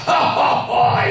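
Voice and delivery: loud booming mock laugh of the German mentor; big hearty laugh. Giant German mentor laughing at the new convert, loud booming laugh. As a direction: throw your head back and guffaw loudly. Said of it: "and he laughed".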